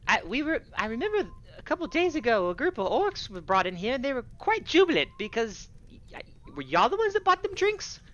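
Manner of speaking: high-pitched tavern keeper voice; flustered tavern keeper. Acted. Game master voices tavern keeper.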